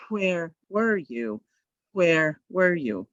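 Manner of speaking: where and were said slowly and kept clearly apart; slow drill. Shown as a demonstration. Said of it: "a really tough one is to go".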